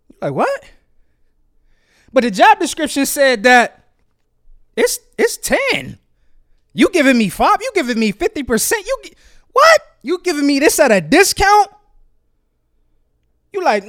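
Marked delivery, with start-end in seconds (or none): 0.3-0.6 sharply rising disbelief; one word jumping up in disbelief; shocked. Acted. Job seeker hearing the offer halved, shocked. 4.7-11.7 high, swooping disbelief; incredulous. Acted. Job seeker challenging an employer over half pay, incredulous.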